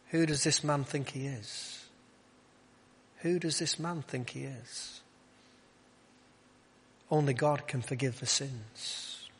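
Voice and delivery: soft, suspicious musing; acted quiet disapproval, well below speaking level; hushed inner thought. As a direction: voice a private doubt under the breath. Voicing the Pharisees' private thoughts.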